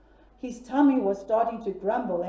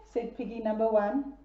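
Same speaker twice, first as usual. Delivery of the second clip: ordinary delivery, nothing marked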